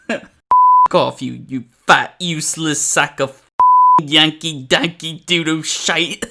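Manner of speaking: mock angry chef insult tirade; slow, punchy mock berating, lightly in character; Chef Ramsay rant. Reviewer imitating a cursing chef insulting someone, mock angry rant. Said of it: "this dude looks like freaking Chef Ramsay".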